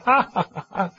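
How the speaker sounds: high mock "ja ja ja" laugh; a hammed-up imitation of a jolly laugh. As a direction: laugh it out like the crowd charmed by him. Mimics everyone laughing along.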